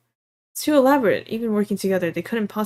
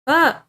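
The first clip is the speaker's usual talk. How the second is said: loud higher yelp of sudden pain; cry of pain; 8 semitones higher, 6 dB louder than usual. Acted. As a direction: gasp out as the head throbs. Pain flash, headache.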